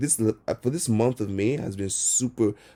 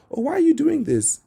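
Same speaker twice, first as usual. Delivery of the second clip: much higher, squeaky excited gush; amazed fan; 4 dB louder than usual. Retold or imitated. Passersby catching him painting, imitated in a high amazed gush. People asking why he paints.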